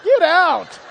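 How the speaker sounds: loud, high playful shout; mock outburst. Preacher joking with his audience, mock-indignant shout. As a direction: holler it in pretend indignation.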